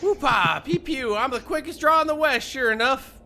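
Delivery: hammed-up gunslinger bragging; whooping cowboy. Gabby boasting about quick draw, loud high cowboy. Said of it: "Gabby doesn't talk like that".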